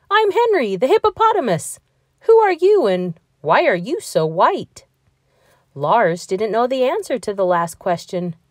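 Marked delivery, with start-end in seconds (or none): 0.1-4.8 friendly hippo voice. Acted. Hippo Henry introduces himself.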